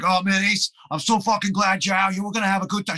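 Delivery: put-on high, hyped-up New York accent; thick New York accent. Singer welcoming new guitarist, thick New York accent. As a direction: gush like an over-eager New Yorker, thick accent. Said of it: "in this thick New York accent".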